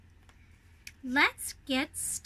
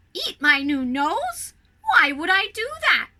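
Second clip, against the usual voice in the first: baffled question; 3 semitones higher, 6 dB louder than usual. A narrator's reading. Olaf baffled.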